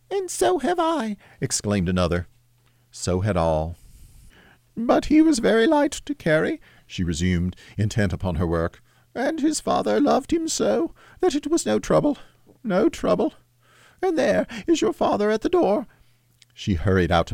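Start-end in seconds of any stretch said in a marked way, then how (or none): 0.1-1.2 put-on very high child's voice; excited child. Younger child joins in, very high exclaiming. 4.8-15.9 hide the grief behind an excuse; sad mother. Acted. Mother's voice again.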